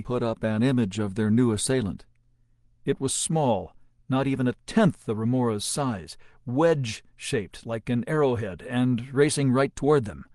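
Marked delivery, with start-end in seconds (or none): none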